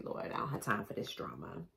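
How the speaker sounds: low, soft, weary grumble; grumpy bear aside. Pretend. Polar bear in the bathtub, low weary grumble. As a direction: mutter it low like a fed-up bear.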